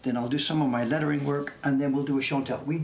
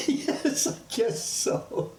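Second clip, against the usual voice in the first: laughing yes; 12 semitones higher, much slower than usual. Real, not acted. Laughing at accent joke.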